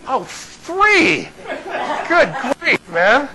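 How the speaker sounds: high, drawn-out comic realization; mock-exasperated relief, voice soaring; dawning realization. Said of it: "And I'm like".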